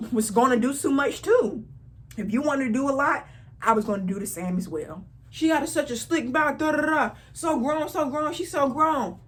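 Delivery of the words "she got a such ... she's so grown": higher, put-on whiny brother voice; mocking brother. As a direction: parrot his "so grown" complaint. Mocking brother's complaint again.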